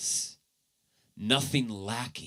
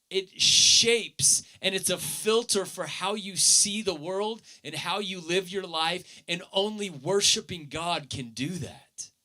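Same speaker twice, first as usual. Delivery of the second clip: higher, louder, urgent sermon delivery; impassioned preaching; 6 semitones higher, 3 dB louder than usual. Acted. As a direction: press the point home with fire.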